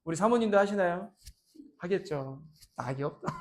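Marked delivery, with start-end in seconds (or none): 2.8-3.3 soft, low resigned murmur; a hint of a sigh; mock weary. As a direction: play the tired grumble lightly.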